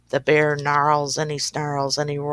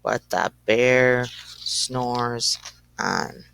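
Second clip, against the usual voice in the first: sinking low refrain; 6 semitones lower, much slower than usual. A narrator's reading. Bear still sleeping, refrain sinks slow and deep. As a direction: let it sink down into sleep.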